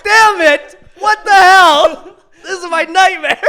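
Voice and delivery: high, loud laughing groan of dismay; laughing mock despair. Recalling being stuck in class after a breakup, laughing dismay. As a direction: laugh through the horror. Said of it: "I was like".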